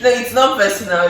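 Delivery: high, loud denial through laughter; laughing, insisting it isn't personal. Real, not acted. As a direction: laugh while denying it.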